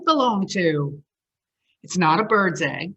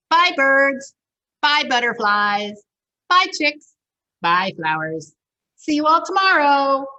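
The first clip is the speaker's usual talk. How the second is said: cheery goodbyes; 7 semitones higher, 5 dB louder than usual. Acted.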